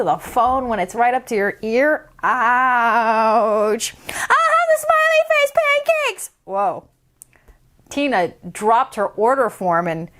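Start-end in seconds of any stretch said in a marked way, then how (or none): 4.1-6.3 put-on high, fast chatty little girl. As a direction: rattle it off like an excited kid. Decibella voice.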